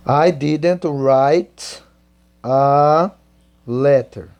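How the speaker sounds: each word slow and separate for students; slow dictation. Shown as a demonstration. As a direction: spell it out slowly word by word. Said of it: "você vai dizer". (ditado bem devagar)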